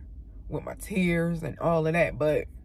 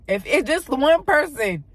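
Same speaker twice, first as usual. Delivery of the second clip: emotional plea; 8 semitones higher, 6 dB louder, slower than usual. Real, not acted. Emotional hope for viewers.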